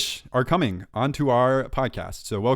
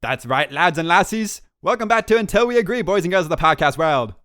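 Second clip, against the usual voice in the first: hammed-up Irish accent, higher and louder; mock Irish accent; 6 semitones higher, 6 dB louder than usual. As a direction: do a loud, high, over-the-top Irish host. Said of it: "that was Irish".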